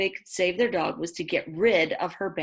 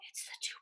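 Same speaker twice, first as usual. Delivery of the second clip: gasp; 19 dB quieter, much faster than usual. Acted. Reader gasps at burglars.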